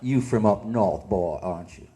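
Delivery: put-on low, deadpan Southern country accent. Local man sizing up a northern boy, low Southern accent. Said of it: "the man would just look at me".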